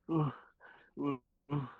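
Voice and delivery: high-pitched mock groans of pain; retold pained groans. Flattened rookie under the Barbarian's foot, mock groaning. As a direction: groan as if stepped on.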